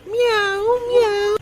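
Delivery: very high cat meows. Retold or imitated. Answering the cat on screen, high mock meows. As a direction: mew like a kitten.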